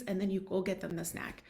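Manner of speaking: usual speaking voice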